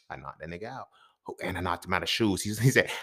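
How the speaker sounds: ordinary talk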